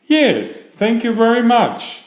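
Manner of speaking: put-on a young bear thanking sweetly, pitched well up; polite little bear.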